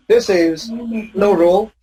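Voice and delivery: usual speaking voice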